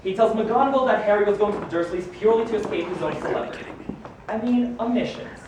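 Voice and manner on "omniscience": ordinary talk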